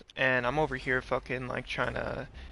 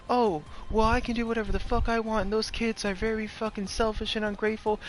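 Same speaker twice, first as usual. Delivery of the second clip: high-pitched imitation of an entitled woman; acted indignant comeback in a raised voice; 7 semitones higher than usual. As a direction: pitch it up, mock self-righteous retort. Acted retort of the confronted lady.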